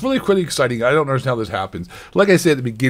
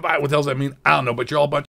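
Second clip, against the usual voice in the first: normal talking voice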